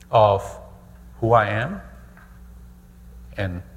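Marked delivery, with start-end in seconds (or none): none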